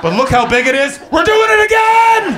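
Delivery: excited American. Retold or imitated. Man before a mushroom cloud cheering, hammed-up shout. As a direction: cheer it on like a thrilled idiot. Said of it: "he should just be saying".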